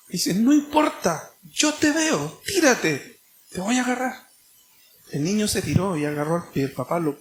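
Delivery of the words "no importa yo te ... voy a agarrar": high-pitched pleading call, much higher; a father begging his son to trust him; reassuring call pitched high and urgent; urging father. Acted. Father urges son to jump.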